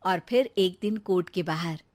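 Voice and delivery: normal talking voice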